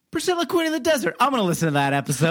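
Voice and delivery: 아주 높고 빠른 흥분한 청취자 흉내; excited listener. Retold or imitated. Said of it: "but they're also going".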